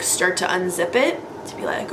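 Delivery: ordinary delivery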